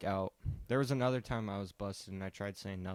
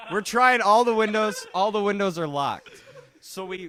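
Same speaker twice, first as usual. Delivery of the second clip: loud, high, laughing storytelling; laughing while telling; 9 semitones higher, 9 dB louder than usual. Real, not acted. Recalling the failed escape through the windows to friends, laughing loudly. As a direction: tell it through real laughter, voice raised.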